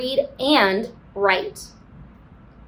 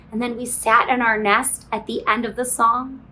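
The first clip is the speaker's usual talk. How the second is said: ordinary talk, nothing marked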